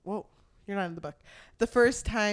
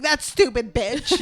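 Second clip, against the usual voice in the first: mock nasty sneer; 4 semitones higher, 10 dB louder than usual. Quoting the roommate's hateful line.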